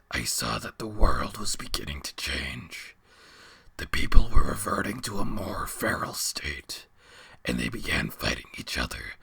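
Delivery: a weary giant creature rumbling from the dark; ancient beast. A narrator's reading. Beast tells his story.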